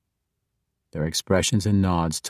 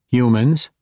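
confused; 5 semitones higher, 5 dB louder than usual. A narrator's reading. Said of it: "clearly confused".

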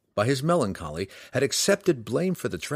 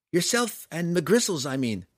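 put-on higher girl's voice, explaining; Canterbury's voice; 8 semitones higher than usual. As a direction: stay in the young woman's voice. Canterbury keeps speaking.

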